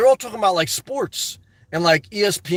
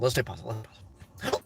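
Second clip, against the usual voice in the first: fading to a whisper; 10 semitones lower, 13 dB quieter than usual. Shown as a demonstration. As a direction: repeat it softer each time until only breath is left. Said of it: "repeats mantra, fading to whisper".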